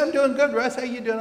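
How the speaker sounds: high, bright, bubbly reply, then corrected; picture her chirping back happily; imagined cheery reply. Retold or imitated. The classmate greeting Russ in the hall, a high chirpy reply. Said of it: "she didn't say that".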